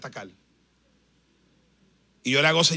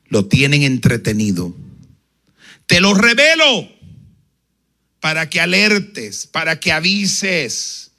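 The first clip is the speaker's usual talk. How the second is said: voice of the Lord; 8 dB louder than usual. Retold or imitated. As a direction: speak it like God revealing a calling. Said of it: "el Señor me dijo".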